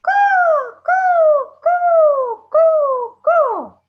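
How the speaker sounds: loud high cawing; caw, caw, a proud crow flying off. Acted. Proud crow flies off calling, loud high caw. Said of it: "he flew by saying".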